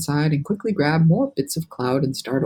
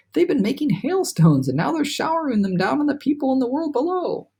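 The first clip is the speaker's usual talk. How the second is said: excited; 10 semitones higher than usual. Acted. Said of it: "whispered James excitedly".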